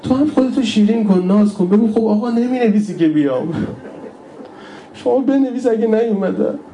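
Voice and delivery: high, pleading mourner's voice; tearful lament preaching. Real, not acted. Preacher longs for the Imam to miss him, tearful pleading. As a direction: plead high, close to weeping.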